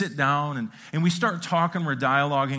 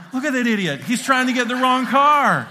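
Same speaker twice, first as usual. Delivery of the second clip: mocking onlookers; 8 semitones higher, 8 dB louder than usual. Imagined onlookers mocking him.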